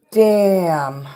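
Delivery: surprised damn. Real, not acted. Surprised by lucky clover gift.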